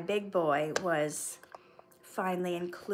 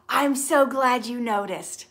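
loud, thrilled gush; gushing delight; 3 semitones higher, 8 dB louder than usual. Real, not acted. Host thanking a viewer who noticed her voice, gushing. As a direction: burst out with delighted gratitude.

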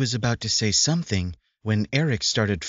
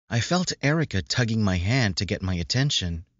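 ordinary delivery, nothing marked

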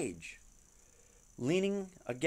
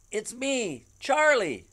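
high, louder eager reply; eager call; 10 semitones higher, 7 dB louder, slower than usual. Acted. Charlie calling at the door.

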